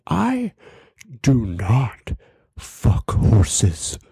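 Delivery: high "I", then spaced-out, dropping words; mock indignant denial. Offended friend denying the accusation, mock indignation. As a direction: declare it with offended deliberation.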